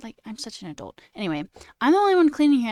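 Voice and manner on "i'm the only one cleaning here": louder, higher assertive line; firm complaint. Retold or imitated. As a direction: stand up to roommates. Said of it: "I was like".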